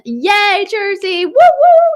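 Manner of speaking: high, loud excited whoops; cheering. Real, not acted. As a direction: cheer for Jersey like a fan. Cheering for Jersey shows.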